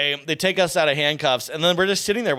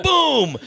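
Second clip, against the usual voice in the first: high-pitched mock boom; imitated boom; 12 semitones higher, 4 dB louder than usual. Storyteller acts out the raid's first bang, high imitated boom. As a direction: act out a sudden bang mid-story. Imitates the SWAT team bursting in.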